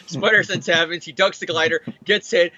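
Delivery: usual speaking voice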